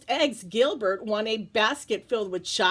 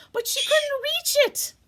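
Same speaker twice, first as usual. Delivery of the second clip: ordinary delivery, nothing marked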